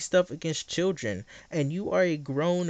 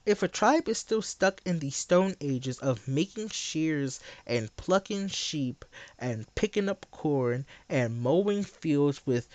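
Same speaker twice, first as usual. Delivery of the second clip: normal talking voice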